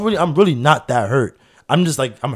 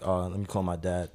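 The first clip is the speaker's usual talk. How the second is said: zachter en lager, bijna onverschillig; zacht en laag; 5 semitones lower, 10 dB quieter than usual. Retold or imitated. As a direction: zeg het zacht en laag, heel nonchalant. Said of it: "This is exactly how I said it".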